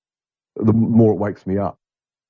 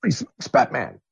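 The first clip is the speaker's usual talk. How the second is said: put-on higher, eager boy-sidekick exclamation, softer; Robin from Batman; 6 semitones higher, 4 dB quieter than usual. Comparing a young guest to Robin, eager sidekick voice. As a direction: chirp it like the eager kid sidekick. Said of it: "or like Robin from Batman".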